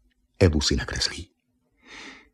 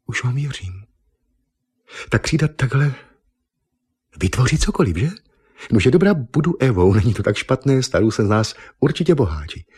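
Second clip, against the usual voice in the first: mildly excited, higher woman's voice; nadšené zvolání; 6 semitones higher than usual. A narrator's reading. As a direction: exclaim with growing eagerness, pitched up. (nadšeně, vyšším dívčím hlasem)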